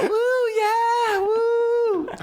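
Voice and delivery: whooping very high like excited Yanks; mock whoop from the crowd; cheering American tourists. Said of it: "there's all Yanks like that".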